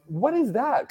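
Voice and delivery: very high, soft, baffled question; puzzled guest imitation. Visitors asking about Play-Doh on the wall, high and puzzled. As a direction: squeak it softly like a baffled visitor.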